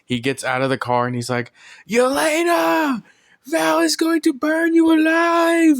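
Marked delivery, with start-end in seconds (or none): 1.9-5.8 high, loud, drawn-out mock scream. Red Guardian gets out of the car to warn Yelena, screaming. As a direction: scream it out slowly, imitating a panicked shouter. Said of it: "He's, like, screaming and stuff".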